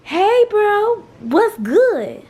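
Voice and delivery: high, loud sassy hey bro; sassy greeting. Shown as a demonstration. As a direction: belt it out with attitude. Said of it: "you gotta say".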